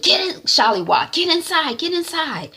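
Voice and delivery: high, insistent orders; urgent mother. Retold or imitated. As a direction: hurry the daughter inside. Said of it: "Mommy says to Shollywood".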